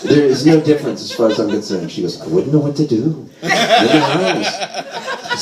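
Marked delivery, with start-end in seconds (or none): 2.2-5.4 slow, drawn-out mock maternal lament.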